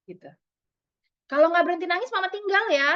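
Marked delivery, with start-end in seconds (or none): 1.3-2.9 higher-pitched acted parental warning; threatening parent. Mother threatening to leave her crying child, raised-pitch warning. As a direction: warn a child as a fed-up mum would. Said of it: "siapa nih yang suka ngomong gini".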